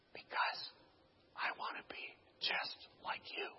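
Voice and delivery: voiceless, hushed confession; barely audible whispered answer; whispering. Retold or imitated. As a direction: whisper it very softly. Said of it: "whispering to each other again".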